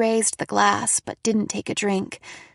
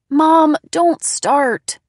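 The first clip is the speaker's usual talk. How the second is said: slow, loud, high protest; exasperated warning; 6 semitones higher, 8 dB louder, much slower than usual. A narrator's reading. Daughter warning mom off the job talk, exasperated. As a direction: cut her off, drawing the words out. Daughter cuts off mom's doubts.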